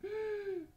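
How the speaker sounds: high sharp mock gasp; a playful high gasp. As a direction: gasp in pretend shock for the children. Reader gasps at Peter's daring.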